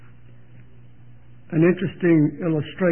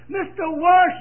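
Maggie's plea; 9 semitones higher, 4 dB louder, slower than usual. Retold or imitated. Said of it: "she said".

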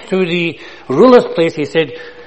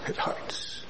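whispered hurt; 17 dB quieter than usual. Retold or imitated. Daughter's pained words retold.

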